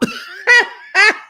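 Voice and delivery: loud high burst of laughter; high cackle. Real, not acted. Host cackling at Kyle's confessional. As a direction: cackle at the joke.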